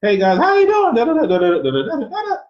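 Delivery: squeaky high, loud mock pubescent voice; hammed-up piping kid babble; cracking-voice mimicry. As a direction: shoot way up into a loud falsetto. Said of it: "And I didn't do the".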